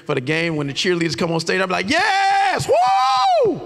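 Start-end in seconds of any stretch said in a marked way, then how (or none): 1.9-3.6 loud, very high whooping cheer; cheering shout. Retold or imitated. Cheer dad when cheerleaders come on, high whooping shout. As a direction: holler like the loudest parent in the stands.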